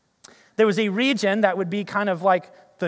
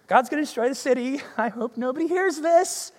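half-hearted preacher; 3 semitones higher than usual. Retold or imitated. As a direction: preach like you hope nobody hears. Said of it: "shuffling his feet around going".

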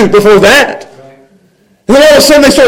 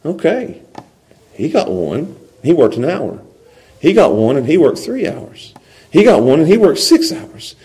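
low, soft, private counting-up; quiet calculating inner voice; thinking to himself; 6 semitones lower, 7 dB quieter than usual. Acted. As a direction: mull it over under your breath. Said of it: "they're sitting there thinking".

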